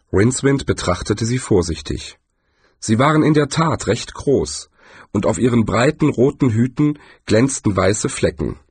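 plain reading voice

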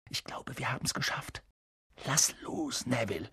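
hushed, very soft murmur to friends; whisper. A narrator's reading. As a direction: keep it under your breath, still hiding. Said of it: "flüsterte Harry".

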